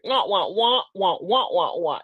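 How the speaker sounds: muted-trombone wah-wah droning; wordless, sinking wah-wah mumble; Charlie Brown's teacher. Retold or imitated. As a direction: imitate unintelligible droning adult speech. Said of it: "you sound like Charlie Brown's, uh, teacher".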